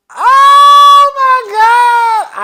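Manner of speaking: sung-out high, loud oh my God; dramatic oh my God. Real, not acted. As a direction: belt it out in disbelief. Dramatic exclamation after story build.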